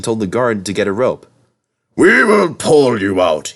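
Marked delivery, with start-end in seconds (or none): 2.0-3.5 slow, loud, higher pompous voice; pompous fat director. A narrator's reading. Fat director threatening Henry, pompous voice. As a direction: puff up like a self-important boss. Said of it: "he said".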